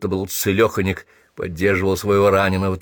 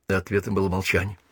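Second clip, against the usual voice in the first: usual speaking voice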